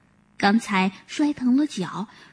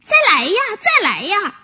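cheeky calling; 8 semitones higher than usual. Acted. Monkey shouting at the fallen deer to try again. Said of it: "小猴喊着".

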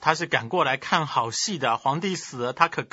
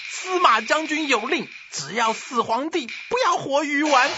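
high-pitched shouted order; furious commanding yell; 11 semitones higher than usual. A narrator's reading. Angry 贾充 ordering the emperor killed, high shout. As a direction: proclaim the order in ringing, high phrases. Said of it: "他大叫一声".

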